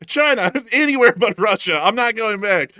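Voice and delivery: high, loud laughing frantic reply; panicked Putin. Pretend. Putin answering Trump, high and frantic. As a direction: refuse to go home in a panic. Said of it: "And he'd be like".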